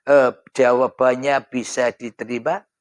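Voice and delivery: usual speaking voice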